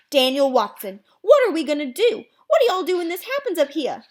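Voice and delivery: high, loud Southern alarm; worried Southern. Acted. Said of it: "started talking Southern style when she got worried".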